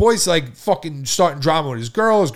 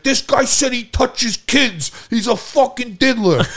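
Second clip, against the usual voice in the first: high, drawn-out indignant yell; slow, high-pitched retold outrage; imitated outraged accusation; 11 semitones higher, 4 dB louder, slower than usual. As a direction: mimic a worked-up friend accusing someone. Retelling enraged friend's accusation.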